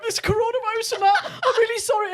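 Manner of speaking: high, loud, slurry sick apology; drunk rambling apology. Retold or imitated. Apologising to paramedics while sick, high and loud. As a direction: ham up the sick, babbling patient.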